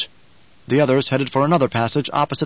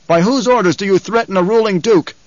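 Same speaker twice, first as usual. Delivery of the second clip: high, raised-voice challenge; imperious called-out question; calling out; 6 semitones higher, 4 dB louder than usual. A narrator's reading. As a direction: demand an answer across a chamber. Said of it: "Paul called to the remaining group".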